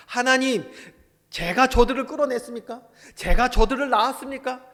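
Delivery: loud, high protest to God; resentful complaint. Retold or imitated. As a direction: cry out in acted grievance, as worn-out Moses. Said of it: "원망하면서 뭐라고 했습니까".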